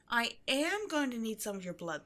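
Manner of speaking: ordinary delivery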